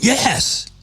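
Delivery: high, excited Buffalo cheer; excited yes. Retold or imitated. Imagined Buffalo listeners cheering at beef on weck, excited. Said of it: "They're like".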